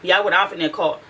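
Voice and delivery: ordinary talk, nothing marked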